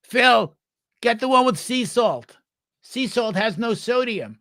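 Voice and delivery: mock high yell down the aisle; grating woman yelling. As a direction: imitate an obnoxious wife calling her husband from afar. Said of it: "she yells down the aisle".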